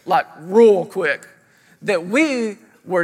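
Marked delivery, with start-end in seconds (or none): 0.1-1.3 REAL stretched out, loud and slow; slow, punched "real quick"; emphatic repeat. Real, not acted. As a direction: lean hard and long on "real". Drawn-out emphatic repeat of phrase.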